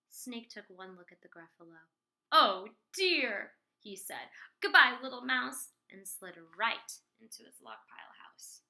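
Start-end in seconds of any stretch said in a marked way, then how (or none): 2.3-3.6 alarmed snake. Acted. 4.6-5.7 alarmed snake. Acted. Snake flees.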